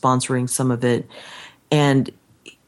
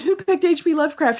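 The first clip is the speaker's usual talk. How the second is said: excited question; 12 semitones higher than usual. Retold or imitated. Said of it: "I said".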